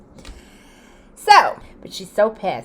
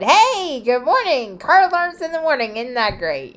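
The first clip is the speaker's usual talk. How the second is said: higher, bright mock-cheerful greeting; sarcastic cheer; 7 semitones higher, 4 dB louder than usual. Texting her friend about the alarms, mock-cheerful sarcasm. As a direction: fake sunny tone to make her laugh.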